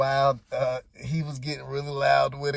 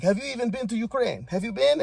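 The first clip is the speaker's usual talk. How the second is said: higher, needling question; mock pointed challenge; 8 semitones higher than usual. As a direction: imitate a spicy retort, pitched up. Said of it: "put a little hot sauce on it".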